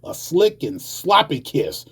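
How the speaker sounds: rhythmic, emphatic poetry performance; spoken-word poem. Acted. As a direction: drive through the images, punching stressed words.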